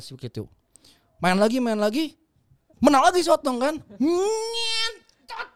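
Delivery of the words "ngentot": put-on high, strained cry of a cheeky kid; half-shouted like a rude village boy; shouted swear from a kid; blurted crude word.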